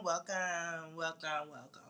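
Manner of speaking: fading welcome. Pretend.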